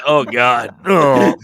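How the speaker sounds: a loud hammed-up groan of relief; mock relieved groan. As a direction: groan it out like someone in the toilet. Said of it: "I was kinda hoping".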